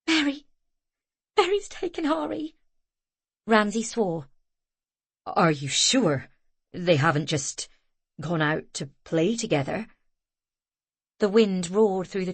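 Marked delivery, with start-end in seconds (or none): 0.1-2.6 panicked. A narrator's reading. Panicked report. 5.2-9.9 low man's voice. A narrator's reading. Ramsay's voice.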